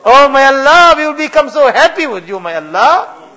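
fervent loud pleading. Real, not acted. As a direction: let the plea swell into a passionate cry. Climax of dua, impassioned crying out.

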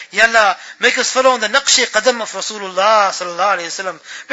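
ordinary delivery

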